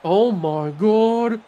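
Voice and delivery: slow, high-pitched awe; amazed excitement. Real, not acted. Player marvelling at the fumble return, amazed and high. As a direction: drag it out, still thrilled.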